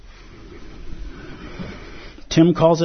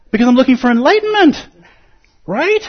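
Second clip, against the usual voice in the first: higher, louder, fast eager seeker; mock eager seeker; 10 semitones higher, 5 dB louder than usual. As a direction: rush it like a frantic seeker. Mocking frantic seeker.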